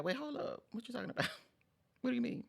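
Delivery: high, incredulous "wait, hold up"; mock indignant disbelief. Vlogger retelling her reaction to bad feedback, mock outraged. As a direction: play it shocked and a little offended. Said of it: "I'm like".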